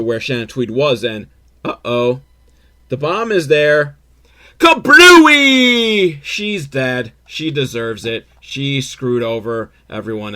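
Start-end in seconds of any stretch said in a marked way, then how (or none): 4.6-6.2 explosion sound. Retold or imitated. Bomb goes off in recap.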